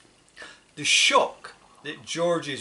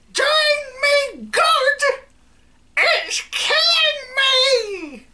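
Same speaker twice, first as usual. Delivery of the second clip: very high, loud, slow wailing scream; screaming old woman; 8 dB louder, much slower than usual. Acted. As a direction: shriek as if dying. Grandma screams in outrage.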